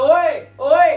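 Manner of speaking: loud, high shout into an empty room; high-pitched, far-carrying call. Acted. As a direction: holler to test the echo. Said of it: "आवाज करते हो".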